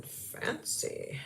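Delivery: deep whispered fancy; a hushed, low imitation of the elf; elf whispering fancy. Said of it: "the elf kept going".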